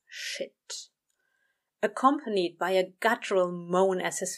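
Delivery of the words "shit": barely voiced, under the breath; hushed swear to himself; soft muttered curse. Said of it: "muttered a soft".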